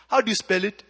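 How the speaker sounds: put-on loud Kenyan-accented lady; imitated Kenyan lady, loud. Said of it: "I'll do the accent".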